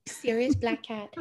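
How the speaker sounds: slow, high voice for the cat; pet talk voice. Real, not acted. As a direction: coo over the cat. Introducing cat named Sirius.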